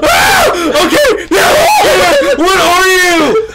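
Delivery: high panicked screaming; scared horror game streamer. Real, not acted. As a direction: shriek in terrified panic, laughing. Monster chase in horror game.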